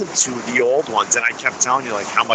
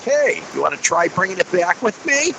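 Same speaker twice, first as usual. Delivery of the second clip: higher put-on voice mimicking a friend; imitating the host's eager pitch, higher; Zerb impersonation; 8 semitones higher than usual. Guest mimicking the host inviting him back, higher put-on voice. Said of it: "that's my Zerb impersonation".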